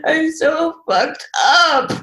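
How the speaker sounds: slow, high, half-laughing admission; laughing confession. Retold or imitated. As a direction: laugh through the words, slowly.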